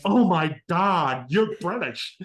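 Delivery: high, loud, slow American gasp; American accent, amazed and loud; excited American. Retold or imitated. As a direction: gush like a starstruck American cashier. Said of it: "And they went".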